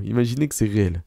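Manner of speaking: ordinary talk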